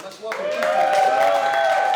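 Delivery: loud, high, slow intro shout; announcer call. Real, not acted. Comic hypes the crowd for the next act, loud and slow.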